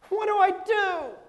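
high, slow desperate wail; crying out. Acted. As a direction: wail it helplessly, acted. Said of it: "to cry out".

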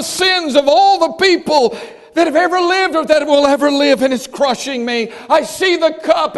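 a touch of Jesus pleading in Gethsemane, loud. Acted. Jesus in the garden begging his Father, pleading loudly. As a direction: voice Christ begging the Father, in earnest. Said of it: "He's pleading with God the Father".

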